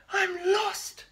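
high loud little wail. A narrator's reading. Spinderella wails, lost outside the school, high and loud. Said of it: "she wailed".

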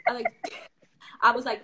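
normal talking voice

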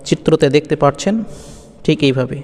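ordinary talk

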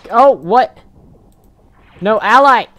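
high, loud frustrated yell; raging gamer. Real, not acted. Killed by an ally.

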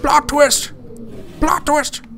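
high, punchy proclamation; mock-dramatic announcement. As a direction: announce it big, high and theatrical.

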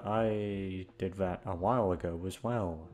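ordinary talk

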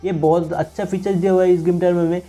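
ordinary delivery

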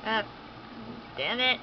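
normal talking voice

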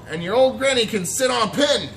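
the pig insulting the wolf's granny; yelled insult. A narrator's reading. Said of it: "the third little pig yelled".

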